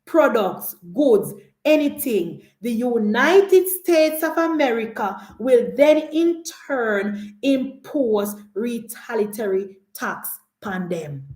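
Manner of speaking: slow, weighty reading of the President's words; measured, slowed-down quote of the President; lightly voiced stern President quoting the tariff threat; slow, deliberate quote. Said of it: "President Trump said".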